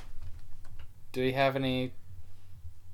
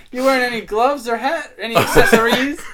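normal talking voice

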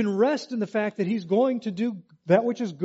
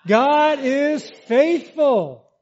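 loud, higher, slow declaration; God is faithful, shouted with faith; with conviction; 7 semitones higher, 8 dB louder, much slower than usual. Shown as a demonstration. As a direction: say it like you believe it. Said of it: "say it like you believe it".

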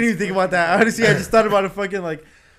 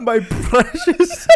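laughing; 6 dB louder, much slower than usual. Real, not acted. Laughing fit.

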